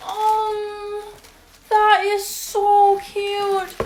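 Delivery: high, loud and slow gushing; excited. Real, not acted. Seeing the pink shoes, slow high gushing. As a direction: stretch it out in delight.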